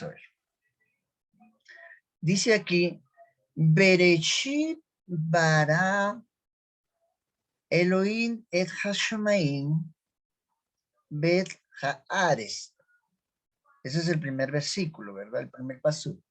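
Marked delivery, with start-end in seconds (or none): 3.5-12.7 slow, drawn-out recitation of Hebrew; slow Hebrew reading. Shown as a demonstration. Teacher reading a Hebrew verse to students, slow recitation. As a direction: draw out each word, pausing between phrases.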